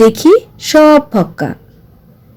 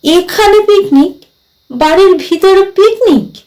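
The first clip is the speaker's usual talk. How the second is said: high-pitched, incredulous questioning; astonished; 8 semitones higher than usual. A narrator's reading. Narrator hears of a picnic indoors, astonished. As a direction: pitch it up as if thunderstruck. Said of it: "আমি যেন আকাশ থেকে পড়ে বললুম".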